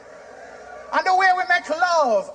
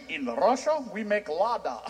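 mock Russian accent; 8 semitones lower, 7 dB quieter, slower than usual. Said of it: "Russia, same thing".

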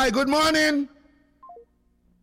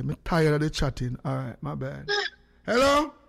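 ordinary delivery

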